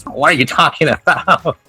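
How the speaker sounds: ordinary talk, nothing marked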